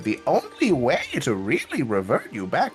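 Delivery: lecturing scholar character. Acted. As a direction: lecture like a theatrical expert, with big pitch swings.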